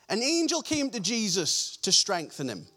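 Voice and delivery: normal talking voice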